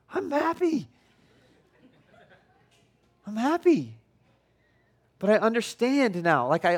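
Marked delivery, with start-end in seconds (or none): 0.1-0.9 very high, strained mock-happy protest; insistent happiness. Father to crying daughter, comically insisting he's happy. Happy dad sounding like yelling. 3.3-4.0 emphatic, raised-pitch mock protest with a firm fall; forceful happiness. Father to crying daughter, forcefully insisting he's happy.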